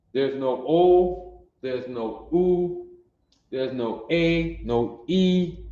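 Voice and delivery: O, oo, A, E each drawn out slowly and stressed; teacher listing vowel sounds; slow emphatic list. Shown as a demonstration. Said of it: "listing the missing vowels".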